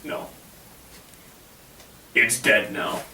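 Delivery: usual speaking voice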